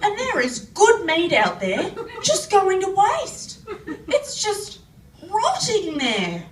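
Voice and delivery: high, indignant impression of Dad; imitating Dad. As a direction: complain loudly as an exasperated country dad. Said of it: "Dad said".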